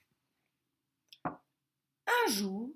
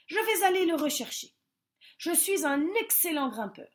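higher, fast, boastful promise; 6 semitones higher, 4 dB louder than usual. A narrator's reading. Bear boasting to the rabbits, higher and quick. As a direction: show off a bit.

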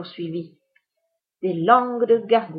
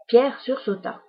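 usual speaking voice

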